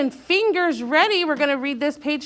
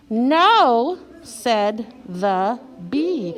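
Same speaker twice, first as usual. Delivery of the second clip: slow word-by-word reading, first word strongest; read-along; 4 semitones lower, much slower than usual. Shown as a demonstration. Teacher leading class reading aloud together, slow and deliberate. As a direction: space every word evenly for young readers. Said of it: "let's read this all together".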